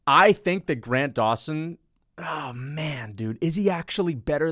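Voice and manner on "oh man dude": breathless whispered aside; awed whisper "oh, man"; real whisper. Real, not acted. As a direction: whisper it as the thought dawns. Stunned realization aside.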